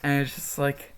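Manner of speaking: usual speaking voice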